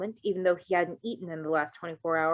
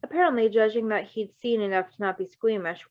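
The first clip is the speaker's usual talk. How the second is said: ordinary delivery, nothing marked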